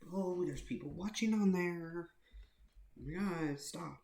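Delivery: put-on shrill panicked girl telling him to stop; panicked girlfriend. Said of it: "She's like".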